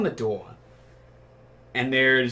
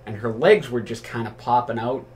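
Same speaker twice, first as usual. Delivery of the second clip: normal talking voice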